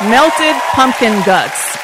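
slow, relishing repeat of melted pumpkin guts; drawn out, amused and gleeful; savouring. Real, not acted. Host savours the guest's insult.

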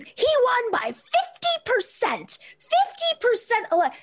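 mild mock yell of her talking point; angry shopper yelling election claims while ramming with her cart; mock screaming woman. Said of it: "she starts screaming at me".

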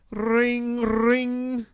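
high, trilled ringing noise; imitated phone ring. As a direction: mimic an old telephone ringing.

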